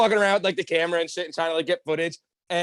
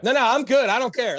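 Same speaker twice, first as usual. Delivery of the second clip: high, louder dismissive quote; acted brush-off; 6 semitones higher, 4 dB louder than usual. Man to warning bouncer, loud cocky brush-off. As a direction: wave the warning away, pitched up.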